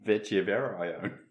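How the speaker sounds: "Fetch Everyone" slowed, said like "Vetty Everaiyone" in the South African Siri voice; imitating Siri's pronunciation. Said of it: "that's the official pronunciation of Fetch Everyone".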